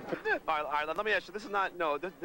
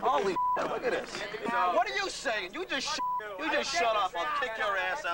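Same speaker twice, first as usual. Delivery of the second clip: angry shouting; 5 semitones higher than usual. Real, not acted. Scuffle breaks out at interview.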